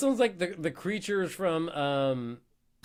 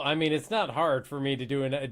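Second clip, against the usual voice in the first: usual speaking voice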